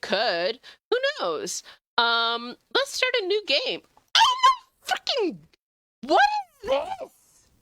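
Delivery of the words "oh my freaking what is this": surprised squeal. Real, not acted. Reacting to a new weird game.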